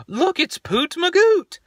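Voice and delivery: put-on very high, slow, sing-song child voice; imitating toddler daughter. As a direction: coo it slowly like a tiny excited kid. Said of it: "standing there at the top of the stairs going".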